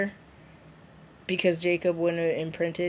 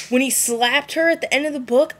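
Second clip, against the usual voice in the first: normal talking voice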